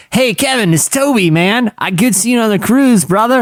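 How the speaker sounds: high-pitched, chummy phone greeting; improvised impression, pitched well above normal. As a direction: pitch it up like hailing an old buddy. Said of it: "how's your [name]?".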